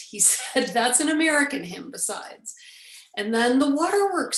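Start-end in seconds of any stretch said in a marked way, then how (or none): 0.7-2.5 higher, louder retold protest; dismissive retort. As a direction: add it as an indignant clincher. Friend dismisses the idea.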